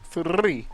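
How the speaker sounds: "Three" with a strong trilled r; rolled r. Shown as a demonstration. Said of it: "I love rolling my R's".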